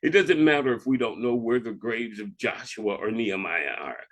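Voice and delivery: ordinary delivery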